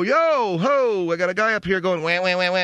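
mock call for help. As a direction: yell to the passing worker. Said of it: "I wanna go".

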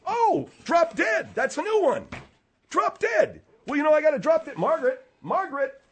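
very high, exaggerated mock delight. Host throws Margaret's "drop dead" back, mocking. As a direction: squeal the insult back at her. Mockingly echoing her insult.

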